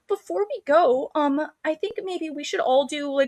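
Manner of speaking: high-pitched girlish imitation; prim bunny. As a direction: mimic a sugary girl making a suggestion. A bunny proposing, voiced.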